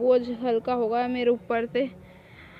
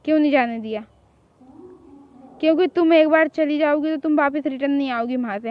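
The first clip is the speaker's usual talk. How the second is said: normal talking voice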